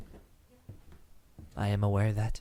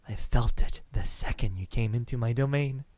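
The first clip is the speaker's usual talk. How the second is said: ordinary delivery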